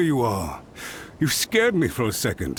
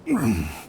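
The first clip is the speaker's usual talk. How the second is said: embarrassed throat clear; 4 semitones lower than usual. Acted. Guard recovers composure.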